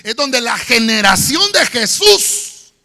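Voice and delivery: loud sung-out preaching; full-force preaching. Acted. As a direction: preach it with music. (predicación a todo pulmón)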